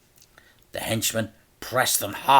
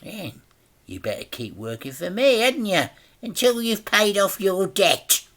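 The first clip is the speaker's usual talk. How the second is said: a hint of sinister crime boss; 10 semitones higher than usual. A narrator's reading. Sinister smile narrated.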